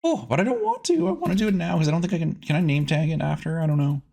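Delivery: ordinary talk, nothing marked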